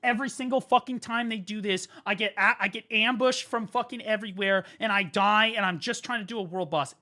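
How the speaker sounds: mock angry player venting; upset gamer rant. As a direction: act out the friend losing it over being ambushed. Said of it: "He goes".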